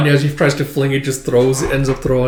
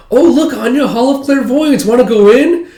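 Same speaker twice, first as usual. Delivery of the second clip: put-on much higher, louder eager kid; excited girl; 11 semitones higher, 7 dB louder than usual. Becky excitedly inviting Anya inside, high eager voice. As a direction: gush the invite like a thrilled schoolgirl.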